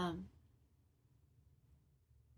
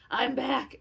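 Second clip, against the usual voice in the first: louder triumphant repeat; louder second I'm back, celebrating; 4 semitones higher, 7 dB louder than usual. Retold or imitated. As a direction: crow it out like a victory. Repeats triumphant line twice.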